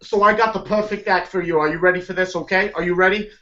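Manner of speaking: a hint of a Brooklyn booker's pitch; lightly put-on Brooklyn hustle; Brooklyn accent. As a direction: sell the band like a fast-talking Brooklyn manager. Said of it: "I have this weird Brooklyn accent".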